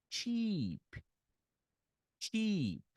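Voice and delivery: high, falling "Cheap" with a long ee; long I vowel. Shown as a demonstration. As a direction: stretch the ee in "Cheap", then a weak puff of p. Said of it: "Agora é um I".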